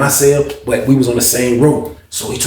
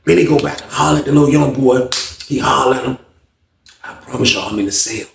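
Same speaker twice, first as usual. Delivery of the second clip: ordinary talk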